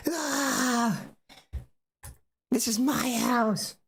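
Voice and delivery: very high, drawn-out indignant cry; hammed-up territorial mother; mock exasperated outburst. Acted mother exploding in a quarrel.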